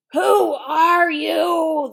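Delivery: loud, slow, high bellow; lion yelling. Acted. Lion yelling at the boy, loud slow bellow. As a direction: boom it out to scare the boy. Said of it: "the lion yelled at my father".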